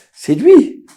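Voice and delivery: high, loud burst of joy; sudden delighted gasp of recognition; excited realization. Acted. Father exclaims as his son arrives.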